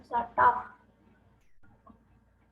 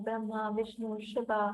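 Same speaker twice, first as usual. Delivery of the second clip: ordinary talk, nothing marked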